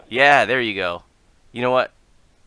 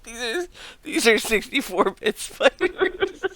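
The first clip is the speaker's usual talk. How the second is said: high, laughing through the words; 5 dB louder than usual. Real, not acted. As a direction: crack up mid-sentence. Breaks up laughing.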